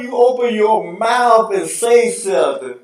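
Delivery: high, loud, pleading with the TV; mock pleading rant. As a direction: scold the announcer, half joking. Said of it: "I'm like".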